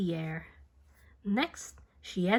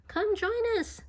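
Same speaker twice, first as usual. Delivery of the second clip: put-on high, bright girl's call; Millie calling; 13 semitones higher than usual. A narrator's reading. Millie invites Beatrice to skate, high eager call. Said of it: "said Millie".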